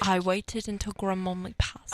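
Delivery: mock British royal accent; Prince Harry's British accent. Host finishing a royal impression for co-host, British accent. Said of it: "Do you wanna hear my Prince Harry impression?".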